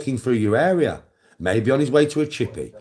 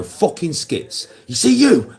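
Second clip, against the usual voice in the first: sudden angry shout, much higher and louder; mock furious yell at son; 7 semitones higher, 4 dB louder, slower than usual. Dad screaming at his son to scare him, angry shout. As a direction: bark it right in his face.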